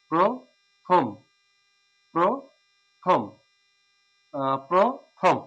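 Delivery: প্রথম said slowly, the first syllable with an o sound; slow model pronunciation. Shown as a demonstration. Teacher slowly modelling প্রথম for students. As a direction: draw প্রথম out, প্রো-থম. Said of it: "এই জন্য ওকার হবে".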